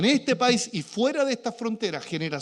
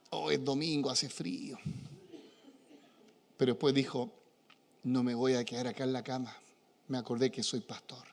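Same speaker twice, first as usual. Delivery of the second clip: sleepy frog voice; 7 semitones lower, 7 dB quieter than usual. Retold or imitated. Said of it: "la ranita está así, levantándose".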